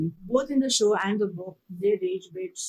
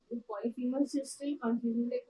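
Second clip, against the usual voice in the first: usual speaking voice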